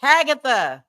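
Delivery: "Hagatha" said with the voice note, falling at the end; echoing "Hagatha"; saying along. Shown as a demonstration. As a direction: repeat "Hagatha" along with the recording. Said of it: "I gotta say it with her".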